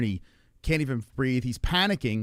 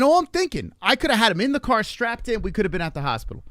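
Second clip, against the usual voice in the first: loud, raised-pitch exasperation; frustrated venting; 7 semitones higher, 4 dB louder than usual. Retold or imitated. Parent regretting waiting for the ambulance, loud frustration. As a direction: vent it hard, pushing the pitch up.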